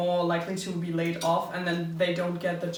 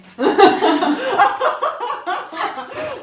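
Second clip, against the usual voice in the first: loud laughter; 12 semitones higher, 12 dB louder than usual. Real, not acted. Laughing at the mock greeting.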